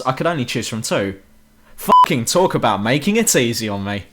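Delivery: usual speaking voice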